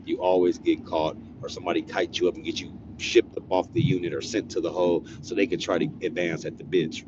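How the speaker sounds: usual speaking voice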